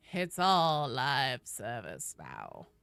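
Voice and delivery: very slow, low, sinking voice; hammed-up defeat, dragged out low; mock resignation. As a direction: let it droop into a gloomy drawl. Gloomy echo of own lament.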